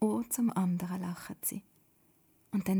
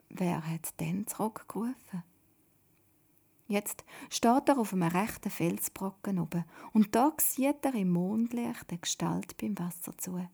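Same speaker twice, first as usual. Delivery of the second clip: plain reading voice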